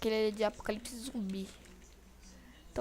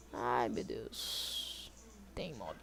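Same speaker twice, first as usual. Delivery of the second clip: whispered, slow and much lower; dread whisper; 11 semitones lower, 6 dB quieter, much slower than usual. Real, not acted. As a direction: breathe it out in dread. Mob appears, dreading it.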